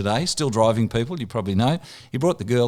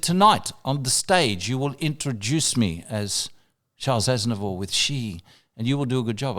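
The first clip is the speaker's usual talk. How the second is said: [name]. Retold or imitated. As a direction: give the order word by word, unhurried. Star giving instructions, retold.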